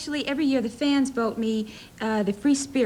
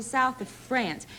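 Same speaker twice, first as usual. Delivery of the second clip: France with a twangy Tennessee vowel; Tennessee accent; 3 dB quieter, much slower than usual. Real, not acted. Actress passing off her origins to host, Tennessee twang on "France". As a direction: let Tennessee show in "France", as "Frants". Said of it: "there's the Tennessee coming through".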